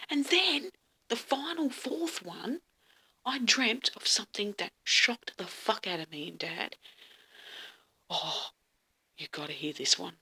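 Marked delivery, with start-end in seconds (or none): none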